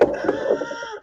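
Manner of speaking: very high piercing mock scream; imitated scream. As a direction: shriek as if someone's being attacked. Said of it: "like, loud scream".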